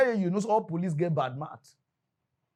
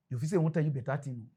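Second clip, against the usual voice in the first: usual speaking voice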